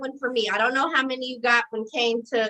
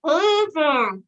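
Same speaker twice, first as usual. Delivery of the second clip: mouth full; 2 semitones higher than usual. Real, not acted. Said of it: "I'll start with two cotton balls".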